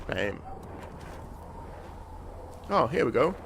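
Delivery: ordinary delivery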